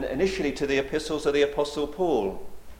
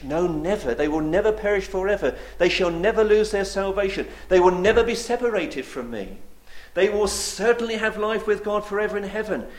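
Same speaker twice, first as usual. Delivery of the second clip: louder and higher, hammering every never; building preaching fervour; 5 semitones higher, 4 dB louder than usual. Acted. Preacher assuring his flock they will never perish, rising fervour. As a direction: drive it home, each line stronger.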